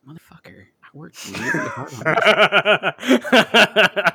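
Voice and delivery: slow drawn-out laughing complaint; Scottish-free mock outrage, slow and laughing; mock indignant. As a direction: stretch it out, half laughing, offended. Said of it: "I'm like".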